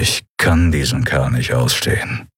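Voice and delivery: low, grumbled dislike; growling. A narrator's reading. Admiral about the departed pirate, growling. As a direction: growl it low under your breath. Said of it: "knurrte Thelios".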